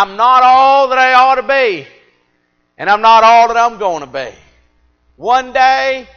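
high, loud preaching climax, held fall on all; full-force preaching. Acted. Preacher to his church, loud full-force preaching. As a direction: preach it out hard to the congregation.

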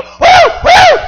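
put-on very high, loud girlish whoops; little girl's whoops. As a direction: imitate a girl shouting for joy. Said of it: "watching her".

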